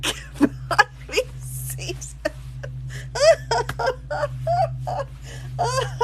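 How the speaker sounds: long high wheezing laugh; high breathy giggle fit; breathless laugh. Real, not acted. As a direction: silent shaking laughter. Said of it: "Oh, I shouldn't laugh".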